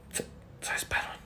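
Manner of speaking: barely audible, halting whisper; secretive whisper. Acted. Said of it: "no te escucho, habla bien".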